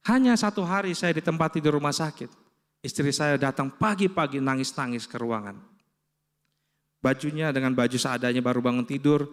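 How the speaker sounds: ordinary talk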